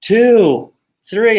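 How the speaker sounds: put-on a mother warning her child, building; mom counting to three. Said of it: "she'd always go".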